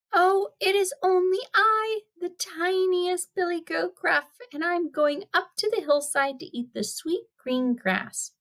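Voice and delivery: very high little goat voice; small, small voice. Acted. Youngest billy goat answering the troll, in a small high voice. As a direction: squeak it high like the tiniest goat. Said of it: "in a small, small voice".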